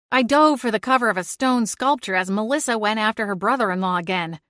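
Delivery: ordinary delivery, nothing marked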